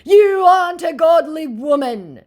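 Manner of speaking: very high, loud put-on voice; hammed-up pious scolding; mock scammer voice. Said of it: "my scammer said".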